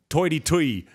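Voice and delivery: hammed-up, badly faked New York accent. Host imitates New Yorkers, badly faked New York accent. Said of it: "what do New York people have".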